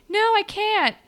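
high, loud whiny refusal; high, loud mock refusal about the unfinished story; mock protest. Retold protest.

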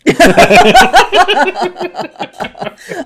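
loud squealing cackle; helpless high-pitched laughter; high shrieking laugh. Real, not acted. Friend jokes about feedback.

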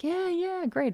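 confident yeah. Retold or imitated. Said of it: "body language is not gonna be like".

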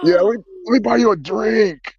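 high, eager starstruck offer; overjoyed. Retold or imitated. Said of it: "I'll be like".

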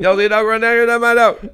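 no spaces, all one word; imitating the woman's frantic rushed question; fast frantic voice. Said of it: "No, no spaces".

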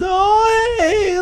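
high, loud put-on cartoon voice; voice actor imitation. And his voice is.

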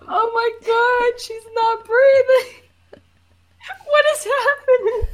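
high, loud mock panic; panicked. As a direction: shriek in mock alarm. Improv panic at drowning.

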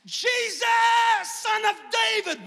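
high, drawn-out cry across a crowd; desperate shout. Acted. Blind beggar calling to Jesus as he passes, shouting. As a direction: call out at full stretch for help. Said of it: "he began to shout".

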